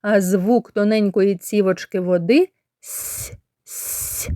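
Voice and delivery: soft, thin whispered с, like "сь"; soft palatalised с. Shown as a demonstration. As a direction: say the с softly, like a trickle. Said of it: "вимовляється м'яко".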